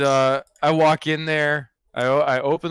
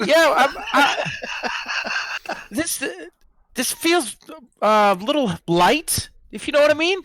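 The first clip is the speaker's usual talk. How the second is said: very high, hesitant recruit voice; tentative newbie; 11 semitones higher than usual. Acted. Recruit questions leader's dice, high hesitant hinting. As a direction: pick your words gingerly, pitched high.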